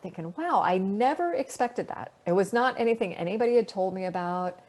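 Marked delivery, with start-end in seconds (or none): 0.4-2.1 a higher, wondering "wow"; retold awe. Astronaut recalling her first shooting star, in awe. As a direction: relive a moment of mild awe. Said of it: "kind of being in awe of that".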